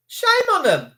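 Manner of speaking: high cry of shame; indignant. Real, not acted. Streamer condemning police on stream, high and indignant. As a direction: call out the police in disgust.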